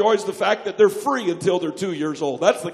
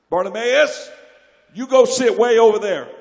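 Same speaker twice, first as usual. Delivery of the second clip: bossy crowd; 8 dB louder than usual. Retold or imitated. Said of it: "crowd telling Bartimaeus".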